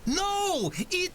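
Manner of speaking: very high orc voice; excited orc. A narrator's reading. Orc begins correcting the keeper, excited. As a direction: start explaining in a high orc voice.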